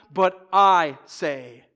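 slow, loud, stretched I; deliberate weight on the word I; emphatic I. Retold or imitated. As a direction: set I apart with heavy stress. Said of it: "The I is emphatic".

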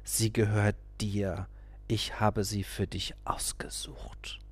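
slow, hushed persuasive whisper; urgent whisper. A narrator's reading. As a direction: whisper it slowly into his ear. Guru urges in whisper.

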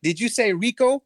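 put-on bravado. EP disguising his voice before the gang, faked bravado. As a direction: fake confidence to hide who he is. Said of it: "bravado in his voice".

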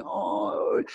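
soft unvoiced oh; breathy realising oh. Actor seeing the girl smile, soft oh. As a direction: let the realisation slip out. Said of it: "And he went".

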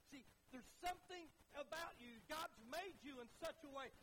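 driving, emphatic pulpit voice; fervent preaching. Real, not acted. Preacher telling church how God's word reaches them, fervently. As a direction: build each phrase with conviction.

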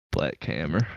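low, slow dramatic echo; dramatic repeat. Real, not acted. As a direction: drag it out low and heavy. Echoing the phrase slow and low.

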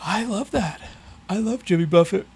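words stretched through a yawn. Real, not acted. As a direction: talk mid-yawn, high and strained. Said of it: "I was yawning".

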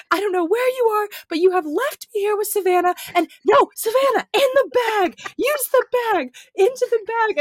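high, loud exasperated rant; mock furious voicemail. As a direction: scold then snap orders at the sick friend. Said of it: "she goes back to being like".

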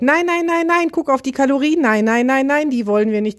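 high, frantic mock shriek; shrieking panic. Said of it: "kreischend im Kreis rennt und schreit". (hoch kreischendes, panisches Nein)

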